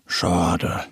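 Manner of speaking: low, dejected little bear; disappointed grumble. A narrator's reading. Little bear with hanging shoulders gives up on the lake, grumbling. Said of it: "grummelt er".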